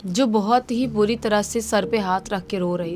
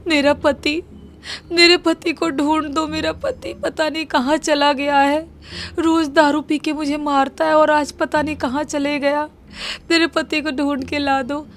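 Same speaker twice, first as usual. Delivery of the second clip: higher-pitched tearful wife voice; crying wife; 5 semitones higher than usual. A narrator's reading. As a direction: plead for her husband through light mock tears, voiced high. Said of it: "रोते तो बोलती है".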